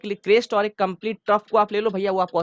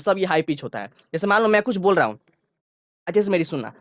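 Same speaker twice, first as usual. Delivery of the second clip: ordinary delivery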